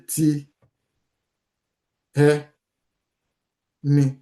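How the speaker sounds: "Ti ẹ ni" said syllable by syllable with long pauses; "Ti ẹ ni" broken up and drawn out; slowly spelled out. Shown as a demonstration. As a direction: break "Ti ẹ ni" apart, no R sound. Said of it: "You just say".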